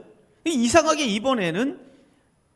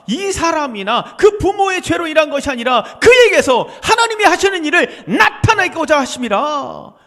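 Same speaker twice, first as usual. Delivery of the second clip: loud, slow, climbing proclamation of each title; preaching at full force; 7 semitones higher, 9 dB louder than usual. Acted. Preacher proclaiming Jesus's titles to the congregation, slow and rising. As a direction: hammer each name of Christ home, louder each time. Quoting scripture loudly.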